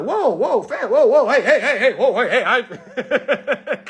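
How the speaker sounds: high, loud frantic whoa-heys; mock panic. To the arresting cops, a laughing mock panic. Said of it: "you gonna be like".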